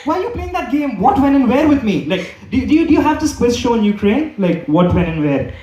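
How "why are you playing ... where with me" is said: higher, laughing disbelief; half-laughing complaint to girlfriend; laughing exasperation. Retold or imitated. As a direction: protest through a laugh. Exasperated reply in the story.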